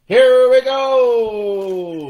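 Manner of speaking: slow, high, loud mock-dramatic announcer voice; hammed-up slow announcer call. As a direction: stretch it out like a big reveal. Dramatic announcement before tasting.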